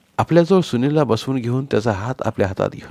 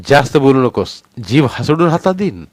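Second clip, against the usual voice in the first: loud menacing husband; husband threatening his answering wife; angry threat; 5 semitones higher, 7 dB louder than usual. Acted. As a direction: threaten her with full force.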